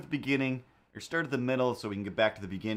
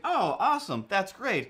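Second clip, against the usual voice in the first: high, loud oh awesome; a bubbly fan; imitating gushing fan; 9 semitones higher, 5 dB louder than usual. Retold fan reacting to what she makes, bubbly and high. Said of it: "she's like".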